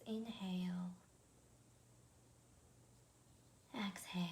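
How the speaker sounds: guided meditation. Acted. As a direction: ease each instruction out gently, leaving room to breathe.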